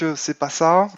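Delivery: ordinary talk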